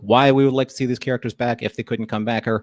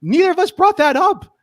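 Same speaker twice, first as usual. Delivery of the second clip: high, loud, indignant declaration; mock outrage; 5 dB louder than usual. Cohost hams up outrage about their show. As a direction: play up the righteous outrage.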